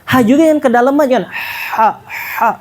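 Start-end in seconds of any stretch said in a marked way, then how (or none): 1.3-2.5 'ha' breathed out lightly, unvoiced, not pressed; soft breathy ha. Shown as a demonstration. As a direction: let 'ha' out like a sigh, no push. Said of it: "Nggak usah ditekan".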